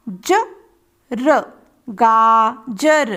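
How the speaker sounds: ताला drawn out very slowly; slow reading demo for children; slow blending. Shown as a demonstration. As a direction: read ताला slowly syllable by syllable. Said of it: "एक साथ मिलाकर पढ़ेंगे".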